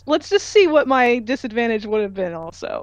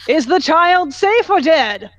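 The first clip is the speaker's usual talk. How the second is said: high, loud, slow demand; announcing loudly; 6 semitones higher, 5 dB louder, slower than usual. Acted. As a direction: call it out loudly to the group, drawn out. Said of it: "and announces".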